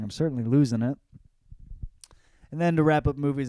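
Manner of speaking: normal talking voice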